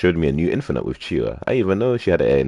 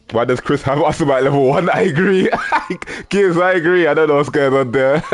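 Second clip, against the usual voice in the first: high, laughing disbelief; 9 semitones higher than usual. Real, not acted. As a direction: talk through your laughter, pitch way up. Cracking up at level one pick.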